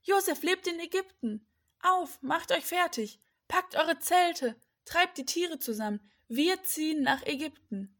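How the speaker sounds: high, rousing announcement; calling out. A narrator's reading. Jakob telling everyone to pack, rousing call. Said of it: "rief er".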